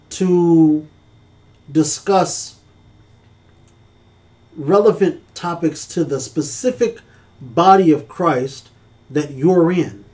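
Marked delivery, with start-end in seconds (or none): none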